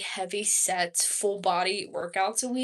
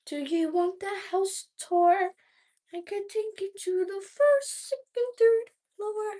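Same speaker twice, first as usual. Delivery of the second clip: tour guide voice; 11 semitones higher than usual. Pretend. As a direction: play a chirpy tour guide showing off the house. Offering a house tour.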